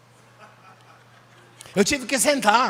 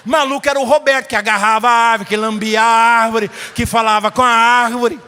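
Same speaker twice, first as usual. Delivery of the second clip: louder, hammering each line; loud punchline; 7 dB louder than usual. Real, not acted. Preacher to the congregation, loud and emphatic. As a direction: drive the punchline home to the crowd.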